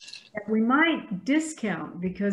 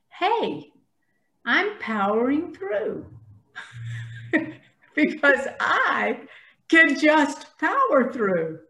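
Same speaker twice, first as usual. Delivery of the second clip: raised higher and louder, slow and playful; slow, playful aha moment, higher and louder; amused self-talk; 6 semitones higher, 3 dB louder, slower than usual. Retold or imitated. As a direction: catch yourself mid-rush with a grin.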